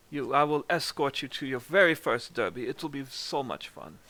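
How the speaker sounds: put-on eager, lilting German-accented gentleman; amateur German accent, full of invitation. Game master as Reese inviting her to the Derby, German accent. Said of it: "My, my German accent sucks too".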